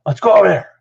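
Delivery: high, loud, irritated order; grumpy. Retold or imitated. Head coach grudgingly moving practice along, grumpy loud order. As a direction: call it out crossly. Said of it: "He's all grumpy".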